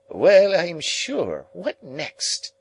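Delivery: very high, drawn-out affront; high, haughty ladylike outrage; affronted scoff. A narrator's reading. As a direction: stretch it high in scornful disbelief. Sarah Pocket scoffs at Pip.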